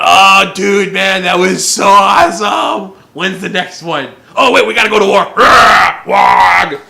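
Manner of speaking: loud, rough partying orc bellowing; rowdy orc shout. Retold or imitated. Orcs after a raging party, bellowing loudly. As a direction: yell it like a hungover orc.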